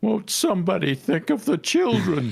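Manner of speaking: high pleading mock outrage; hammed-up Won't-somebody-think plea; mock gasp. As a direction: wail it like a scandalized parent. Mock meme line about children.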